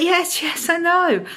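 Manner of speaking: high, weary brush-off; exasperated. Retold or imitated. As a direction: wave off the nagging thought. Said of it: "I'm like".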